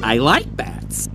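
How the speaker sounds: slow raccoon voice impression. Shown as a demonstration. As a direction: do the raccoon's line slowly. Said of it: "the way the raccoon says".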